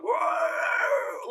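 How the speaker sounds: wild creature shout of amazement; monster whoa. Retold or imitated. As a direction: shriek it like a cartoon monster. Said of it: "Ashman monster being like".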